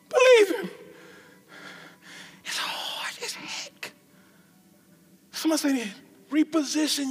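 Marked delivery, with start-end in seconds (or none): 2.4-3.9 hushed aside; whisper. Real, not acted. Preacher admitting the struggle in a whisper. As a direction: drop to a whisper.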